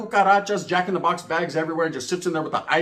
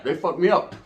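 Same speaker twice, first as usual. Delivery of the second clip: ordinary talk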